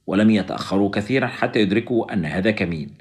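plain reading voice

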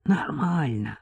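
weak, husky near-whisper; hoarse. A narrator's reading. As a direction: answer softly through a sore throat. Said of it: "хрипло ответила Маша".

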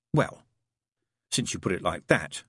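hesitant, considered concession; slowly. A narrator's reading. Aziraphale giving in to Crowley, slowly. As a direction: pause after "well", then concede. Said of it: "said the angel slowly".

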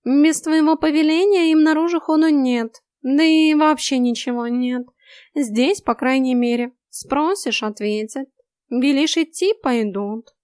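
high, loud know-it-all cat; lecturing cat. A narrator's reading. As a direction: lecture her like a smug tomcat. Cat explaining.

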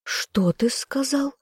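high, slow hissing whisper; slow, high whispered hiss of offense. A narrator's reading. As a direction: hiss it out under the breath, stung. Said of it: "зашипела я".

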